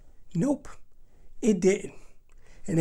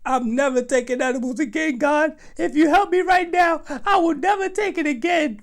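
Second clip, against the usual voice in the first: higher, louder pleading to God; praying for rescue, hammed-up desperation; desperate prayer; 6 semitones higher, 3 dB louder than usual. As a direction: bargain with God in panic. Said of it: "All I could think is".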